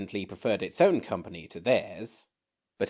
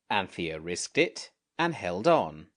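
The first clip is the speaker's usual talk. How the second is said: usual speaking voice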